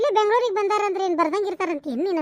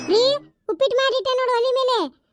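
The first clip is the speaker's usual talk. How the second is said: calling out; 6 semitones higher than usual. Acted. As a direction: call out to the husband in the next room. Wife calls husband from kitchen.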